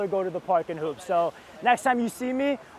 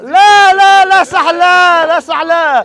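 high, loud Arabic court shouts; urgent shouting; 6 semitones higher, 10 dB louder, faster than usual. Acted. Player yells to teammates in Arabic, urgent shouting. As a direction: holler frantic calls across the court. Calling out during the game.

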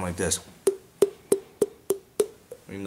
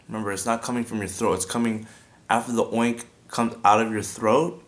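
ordinary talk, nothing marked

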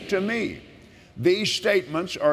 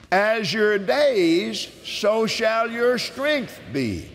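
preaching with force; 6 semitones higher, much slower than usual. Acted. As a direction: declare it like a sermon. Slow emphatic proclamation.

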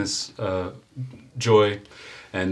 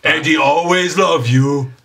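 higher, louder, fond goodbye in a monster voice; yeti farewell; 5 semitones higher, 8 dB louder than usual. Acted. Yeti waves goodbye to the party, fond and loud. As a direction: say farewell like a big-hearted yeti. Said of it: "waves and says".

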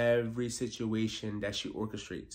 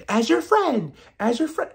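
high, louder mock pleading; mock self-righteous; 12 semitones higher, 7 dB louder than usual. Mocking Gizelle claiming friendship, high and loud. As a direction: say it with fake sincerity.

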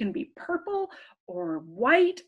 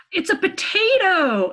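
higher, louder big reveal; excited reveal; 6 semitones higher, 7 dB louder than usual. Acted. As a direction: announce it with delight. Revealing the answer to kids.

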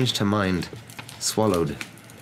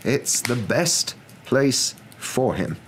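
slow, deliberate, pitched up; firm; 7 semitones higher, much slower than usual. A narrator's reading. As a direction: state it firmly, word by word. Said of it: "said Dumbledore firmly".

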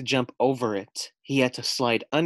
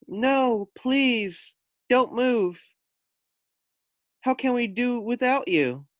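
pleading; 13 semitones higher, 3 dB louder, slower than usual. Acted. Friends beg Frog to stay.

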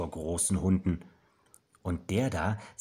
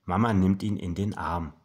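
ordinary delivery

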